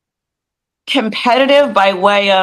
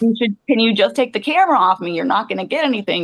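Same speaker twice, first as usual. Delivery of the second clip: fast nervous plea; faster than usual. Retold or imitated. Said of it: "I'm just like".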